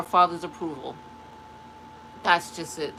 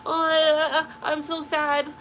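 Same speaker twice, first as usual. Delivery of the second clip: high, hammed-up woe-is-me moan; theatrical fake lament; mock wailing; 6 semitones higher, slower than usual. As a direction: parody a self-pitying sad sack, much higher. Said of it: "Don't sit".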